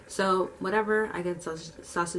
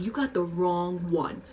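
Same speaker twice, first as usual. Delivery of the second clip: slow, stretched-out warning; drawn-out warning; slower than usual. Acted. Worker warning rude customer, slowly drawn out. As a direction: draw it out in your own pitch. Confrontation, first take before deep repeat.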